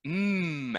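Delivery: hummed "Mm" with closed lips; lip sound mm. Shown as a demonstration. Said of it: "I go".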